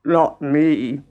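grumpy frog voice; grumbling frog. A narrator's reading. Frog refusing to knead the dough, grumbling. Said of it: "grumbled the Frog".

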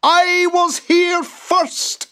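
very high, slow, loud yell; boggart yell. Acted. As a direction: bellow it like a tiny tyrant. Boggart arguing.

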